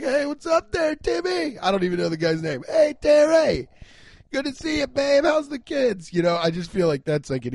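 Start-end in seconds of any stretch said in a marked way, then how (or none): 0.0-1.6 much higher, cheery suburban dad hello; friendly neighbor greeting. Retold or imitated. Griller greeting a distant neighbor, much higher and cheery. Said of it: "I'm like". 2.7-6.1 much higher, slow and loud suburban dad call; hammed-up friendly neighbor. Griller hailing the neighbor across the lawn, much higher and hammy. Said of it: "I'm like".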